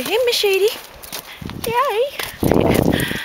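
squeaky high praise voice for a dog; high-pitched dog praise. Real, not acted.

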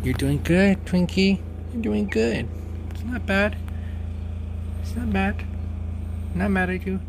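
high, sweet puppy talk; pet voice. Real, not acted. Reassuring the new puppy.

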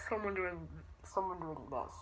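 low, soft muffled villain voice; Bane. Shown as a demonstration. Vlogger demonstrates what Bane sounds like, low and soft. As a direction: drop down into a hushed villain voice. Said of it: "I think the Bane voice is just".

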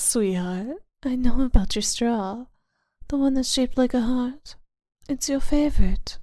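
usual speaking voice

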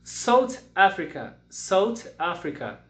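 very slow, clear South Africa; teacher drilling for class repetition; slow model word. Shown as a demonstration. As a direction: drill it slowly for repeating. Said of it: "repitam comigo".

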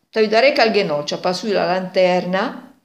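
plain reading voice

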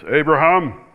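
friendly loud calling out of the name; warm loud call. Shown as a demonstration. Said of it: "To me, it would be like this".

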